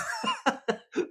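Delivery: high laugh. Real, not acted.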